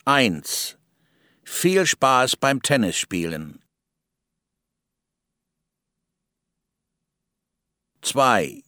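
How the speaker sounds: German sp and st said as shp and sht in Spaß and Tennisspielen; model German sentences. Shown as a demonstration. Speaker reading example sentences for learners, clear and even. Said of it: "Listen and repeat".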